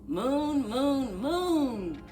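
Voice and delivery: usual speaking voice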